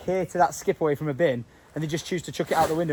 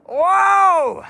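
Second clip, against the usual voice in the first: high, loud falling whoa; real astonished whoa; surprised shout; 11 semitones higher, 7 dB louder than usual. Real, not acted. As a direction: gasp of amazement out loud. Pulling out a device.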